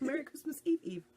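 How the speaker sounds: high, cheery holiday wish; festive greeting. Real, not acted. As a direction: sing out a merry greeting.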